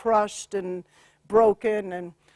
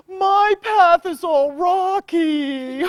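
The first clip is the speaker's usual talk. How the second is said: slow, higher whining lament, a touch of crying; mock whiny complaint; 7 semitones higher, 5 dB louder, much slower than usual. Healer imitating her client's whining, slow and high. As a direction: whinge it out like a tired child. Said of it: "And she's like".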